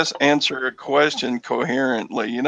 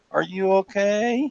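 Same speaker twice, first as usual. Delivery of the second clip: slow, higher pitched mock concern; mock concerned ask; 7 semitones higher, 4 dB quieter than usual. Friend mocking someone asking after an injury, slow and higher. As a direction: drag out a silly worried question. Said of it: "I didn't say it like that".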